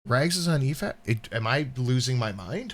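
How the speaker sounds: ordinary talk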